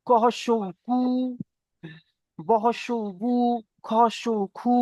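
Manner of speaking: "কু", "বু", "খু" each on a held oo; spelling-out drill. Shown as a demonstration. Teacher showing the pesh sound, "কু", "বু", "খু" with rounded oo. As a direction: spell out, then hold "কু", "বু", "খু". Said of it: "এমন ভাবে পেশের উচ্চারণ হবে".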